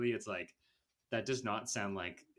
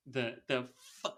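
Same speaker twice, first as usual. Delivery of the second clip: usual speaking voice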